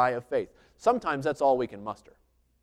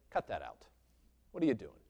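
stern God voice; 4 semitones lower, 7 dB quieter than usual. Pretend. Voicing God rebuking a praying believer.

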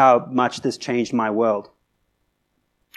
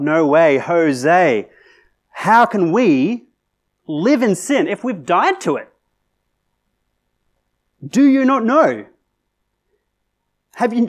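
cap locks emphasis; 3 semitones higher, 4 dB louder than usual. Acted. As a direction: shout it in capital letters. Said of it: "that's in cap locks".

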